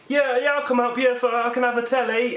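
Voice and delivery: high-pitched put-on voice; mock-keen agreement, pitched right up; eager taker. Villagers agreeing to help Noah for a bribe, high eager voice. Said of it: "people were like".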